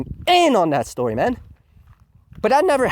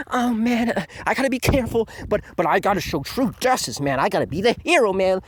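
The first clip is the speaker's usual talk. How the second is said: higher, eager, goofy nerd voice; mock naive nerd; 7 semitones higher than usual. Mocking the naive hero Shinjiro, eager nerd voice. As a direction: play a giddy, naive wannabe hero. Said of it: "mocking naive hero Shinjiro".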